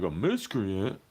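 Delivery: puzzled rising put-on voice; mock confused driver. Mocking the driver not knowing the word, puzzled. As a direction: ask it like you've never heard the word. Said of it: "He was like".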